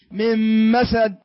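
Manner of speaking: من مسد slow, like a double meem "mim-masad"; merged noon. Shown as a demonstration. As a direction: say من مسد as two meems. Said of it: "كأنك تنطق ميمين". (كأنك تنطق ميمين ببطء)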